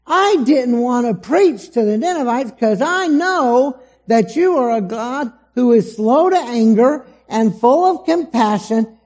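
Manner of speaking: resentful Jonah. Retold or imitated. Jonah complains to God about sparing Nineveh, resentful. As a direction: Jonah griping at God, indignant. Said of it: "different intonation of the voice".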